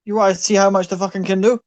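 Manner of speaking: roaring Irish fight boss; Irish accent. Acted. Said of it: "Your Irish accent is fantastic".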